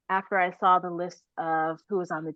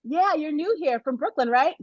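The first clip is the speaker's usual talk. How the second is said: higher, lightly voiced coach checking in; a friendly coach, pitched up; 9 semitones higher than usual. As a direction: coach greeting the new girl from Brooklyn, higher. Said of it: "And she's like".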